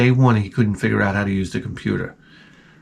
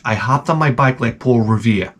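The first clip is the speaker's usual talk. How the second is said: ordinary talk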